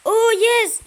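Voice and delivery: high, loud triumphant yell; loud happy gamer yell; loud excited cheer. Real, not acted. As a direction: shout the win. Win in game.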